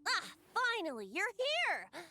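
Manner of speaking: high-pitched, glad welcome; delighted greeting. Acted. Bard welcomes an awaited friend, delighted. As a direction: greet them brightly, pitched well up.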